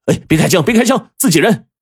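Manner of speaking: urgent high-pitched plea not to shoot; hurried shout. A narrator's reading. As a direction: call out quickly to stop friendly fire. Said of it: "赶紧就齐声喊道". (急忙高声喊停)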